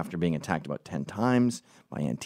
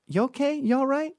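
sugary fake sympathy as she passes; sarcastic concern. Touching the woman's arm as she walks by, high mock concern. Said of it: "I was being sarcastic and facetious".

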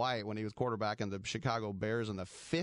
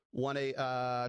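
usual speaking voice

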